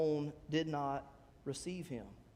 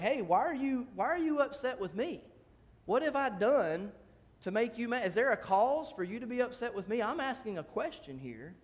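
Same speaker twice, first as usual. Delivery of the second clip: higher-pitched, indignant questioning; wounded protest; 5 semitones higher than usual. Acted. David asks his brother why he is upset, protesting. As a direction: plead innocence to an angry accuser.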